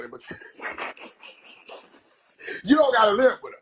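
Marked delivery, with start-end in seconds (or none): 2.6-3.6 laughing. Real, not acted. Retorting while laughing.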